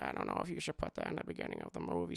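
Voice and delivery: hint of a timid writer's doubt; meek George voice. George quietly doubting Steven's opening scene, low and fast.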